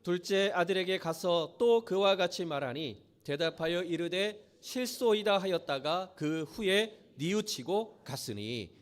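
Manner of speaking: measured reading of a Bible passage, steady and solemn; scripture reading. Acted. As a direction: read the verses aloud to the congregation. Reads scripture passage aloud. (성경 봉독하듯 차분하게)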